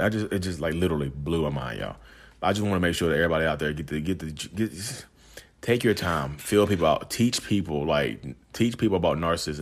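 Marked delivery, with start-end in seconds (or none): none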